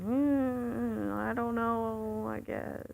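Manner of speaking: drawn-out, awkward hedging; frozen up. Retold or imitated. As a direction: act out freezing up, stretching the words. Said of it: "freeze up and".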